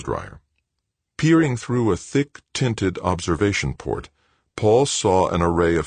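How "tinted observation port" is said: ordinary delivery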